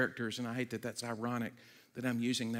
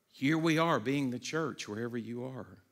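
ordinary talk, nothing marked

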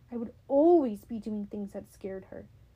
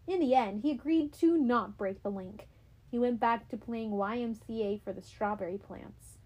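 ordinary delivery, nothing marked

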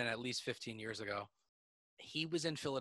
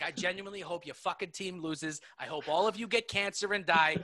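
heated rant, higher and punchy; mock tirade, pitched up; Bill Burr; 7 semitones higher, 5 dB louder than usual. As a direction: imitate a comic ripping into a booing crowd. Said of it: "He is ripping them apart".

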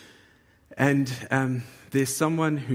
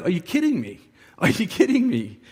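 loud, high, laughing disbelief; med student scoffing at arguing doctors, laughing disbelief; exasperated disbelief; 5 semitones higher, 5 dB louder than usual. Retold or imitated. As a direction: scoff at their helplessness.

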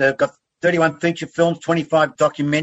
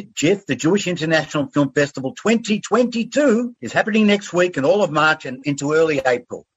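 ordinary talk, nothing marked

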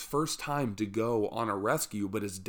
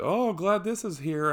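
breezy, higher, offhand; playing it off; 9 semitones higher, 4 dB louder than usual. Fallen rescuer shrugging it off to the climbers, casual and high. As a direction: brush off a near-death fall lightly. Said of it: "he plays it off like".

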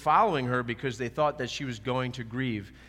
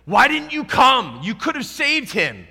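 Mary's grief bursting out, pleading and wailing; high, loud, sobbing reproach to Jesus; anguished crying plea; 11 semitones higher, 13 dB louder than usual. Retold or imitated. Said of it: "Jesus sees her crying".

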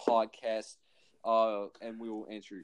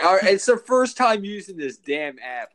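loud, raised-pitch defensive outburst; loud protest; 7 semitones higher, 11 dB louder than usual. Real, not acted. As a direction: cut in loudly to defend yourself. Arguing back at co-host's complaint.

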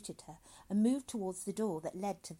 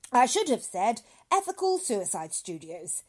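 high, loud cheery greeting; hearty doctor voice; 4 semitones higher, 13 dB louder than usual. A narrator's reading. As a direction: greet him like a jolly doctor. Dr Hitz, loud.

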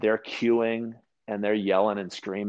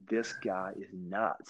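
slow, much softer disbelieving mutter; muttered aside; 2 semitones lower, 10 dB quieter, much slower than usual. Retold or imitated. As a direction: mutter it under your breath, slowly. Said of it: "And I was like".

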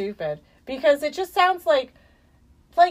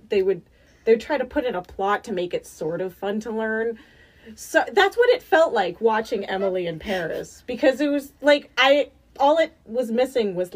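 ordinary talk

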